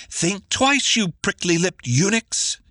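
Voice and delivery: a cranky woman warning the soldiers off; ill-humored, commanding. Acted. Fetching threatens the lancers, ill-humored and commanding. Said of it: "ill-humored, commanding".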